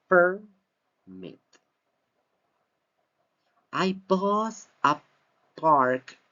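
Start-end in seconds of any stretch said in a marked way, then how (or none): 0.1-1.4 Permit stressed on PER; noun stress on PERMIT. Shown as a demonstration. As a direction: split Permit slowly, first syllable high and strong. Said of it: "permit here is a noun".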